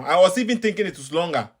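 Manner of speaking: ordinary talk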